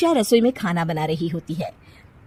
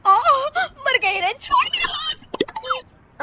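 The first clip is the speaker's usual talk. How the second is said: high, dragged-out wail of pain; crying out in pain; much slower than usual. Acted. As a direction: arm twisted, begging to be let go. Arm twisted in pain. (दर्द से कराहती आवाज़)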